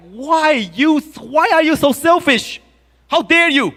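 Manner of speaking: high, indignant rebuke; angry Moses. Retold or imitated. Moses rebuking the selfish tribes, outraged and high. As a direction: scold them like an outraged leader. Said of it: "And Moses got very angry".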